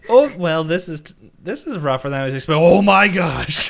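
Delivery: hammed-up panicked imitation; mock panic. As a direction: start rattled, end in exaggerated horror. Imitating scientist being attacked.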